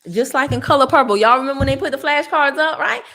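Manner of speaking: excited loud aside. Real, not acted. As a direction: call it out to the crowd. Excited reference.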